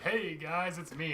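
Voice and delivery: high, loud, showy greeting; imitated breezy hail. Lando calling out to the whole galaxy, mock cheery. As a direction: call out cheerily as if to everyone. Co-host voicing Lando's broadcast.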